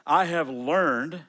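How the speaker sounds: "I have learned" drawn out slow, each word weighted; slow stressed reading. Shown as a demonstration. Pastor reading Philippians to the congregation, slow and stressed. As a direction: lean hard on every word of "I have learned".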